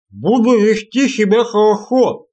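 slow, chewing while talking; mouth full. A narrator's reading. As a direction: talk through a mouthful of stew. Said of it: "прожевывая очередной кусок тушёнки".

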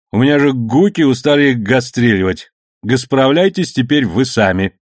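"гуки" and "гастреливать" with a throaty burred r; strong burr, every r a guttural g. Acted. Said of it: "сильно картавя".

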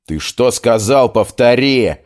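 loud menacing challenge; threatening shout. Acted. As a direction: demand it like a fight is coming. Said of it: "Денри угрожающе махнул кулаком".

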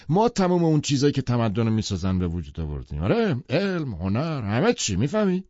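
high, emphatic bluster, slowing to a close; Tom, pompous. A narrator's reading. Tom holding forth on his book, pompous. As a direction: preach the theory as settled fact.

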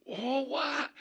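very high, startled exclamation; astonished outburst. Retold or imitated. Doctor seeing his patient walk, astonished outburst. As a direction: let the voice leap up in disbelief. Doctor amazed to see mom walking.

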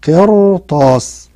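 قرطاس drawn out very slowly, R thick and heavy; slow recitation-style قرطاس; heavy R demo. Shown as a demonstration. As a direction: stretch قرطاس with a full heavy R. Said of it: "تقول هي مفخمة".

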